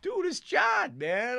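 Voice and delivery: high, loud protest; exasperated shout. Retold or imitated. Grandson protesting to grandmother, loud exasperated shout. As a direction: yell it in disbelief at her. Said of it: "I was like".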